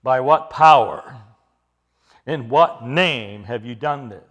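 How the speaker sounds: low, loud, slow accusation; pointed questioning. Acted. Council singling out Peter and John, pointed slow demand. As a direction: bear down hard on each key word. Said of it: "they pointedly point out to Peter and John".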